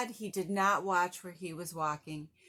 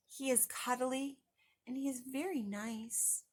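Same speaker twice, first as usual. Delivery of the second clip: put-on soft child voice; 4 semitones higher, 7 dB quieter than usual. Child dreamily describing his doll.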